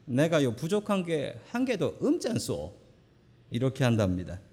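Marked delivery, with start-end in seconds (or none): 0.0-2.8 high, sing-song Gangwon accent; Gangwon dialect. Shown as a demonstration. Pastor performing a high sing-song Gangwon dialect to amuse the congregation. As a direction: call it out in a high Gangwon lilt. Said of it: "강원도 버전은 이렇답니다".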